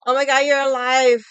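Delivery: very loud fake shock; mock surprise. Mocking a friend who texted after a silent weekend, shouted. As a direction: shout it like he's amazed she lives. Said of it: "I didn't reply to you the whole weekend".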